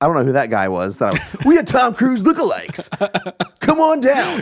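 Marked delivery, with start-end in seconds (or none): 1.5-4.4 announcer voice. Pretend. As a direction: shout it like a radio ad. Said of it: "I like that voice".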